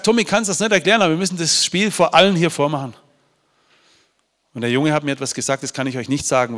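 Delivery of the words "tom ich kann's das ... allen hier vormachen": high, loud, pressing him to come along; eager urging. Retold or imitated. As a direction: talk him into it with rising excitement. Urgently telling Tom about the game. (eindringlich und laut, hoch aufgeregt)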